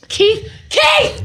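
high mock call to Keith. Calling out to Keith in a high voice. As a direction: sing out his name in a high pitch.

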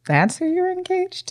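high, soft incredulous squeak; mock disbelief. Reacting to friend's burly fiancé in high disbelief. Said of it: "And I was like".

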